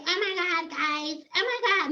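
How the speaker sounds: small, high put-on voice; mock timid girl. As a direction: mimic a dainty, helpless influencer. Mockingly voices a timid Harriet Tubman.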